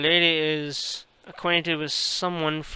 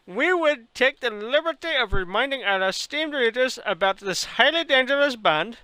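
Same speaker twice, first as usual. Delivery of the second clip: put-on Indian accent; 3 semitones higher than usual. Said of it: "talk this long with an Indian accent".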